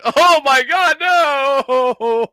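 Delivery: high, loud mock wail; hammed-up cry of dismay; imagined horrified cry. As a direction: cry out in pretend horror, drawing out the end. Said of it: "Steve put his finger in there and went".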